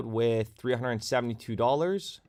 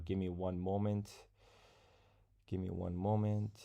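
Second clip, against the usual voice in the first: distracted, quiet stalling; 3 semitones lower, 10 dB quieter than usual. Real, not acted. Streamer stalling viewers while checking on a giveaway, distracted and quiet. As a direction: murmur it absently while busy with something else.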